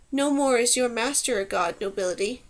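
ordinary delivery, nothing marked

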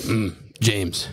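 a hint of a stern drill sergeant calling a recruit; lightly voiced sergeant catching a recruit on the toilet; sergeant's voice. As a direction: mimic the kill hat's drawn-out "Mm, James". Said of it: "He's got the voice".

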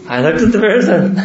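chuckling as he tells it; laughing. Real, not acted. Teacher chuckles at a student's excuse while laughing. Amused retelling.

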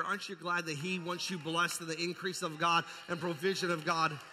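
usual speaking voice

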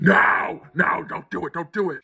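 low, pleading no, no, don't do it. Retold or imitated. Boyfriend begging his girlfriend not to bleach, low pleading. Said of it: "And he's like".

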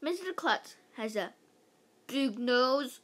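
usual speaking voice

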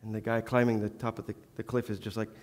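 ordinary talk

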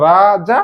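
রাজা drawn out, rising on the last syllable. Shown as a demonstration. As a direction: hold both "aa" sounds in রাজা. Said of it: "তাহলে উচ্চারণ করো".

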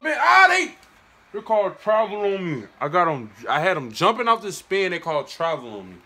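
loud, high protest at the call; angry gamer rant. Real, not acted. Complaining about game ref call.

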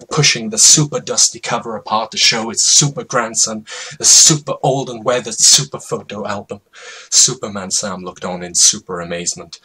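plain reading voice

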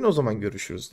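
mother throws görüşürüz back very low and quiet; echoed threat. Retold or imitated. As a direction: answer the threat with the same cold tone. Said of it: "Annem de diyor ki".

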